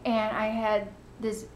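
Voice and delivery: ordinary talk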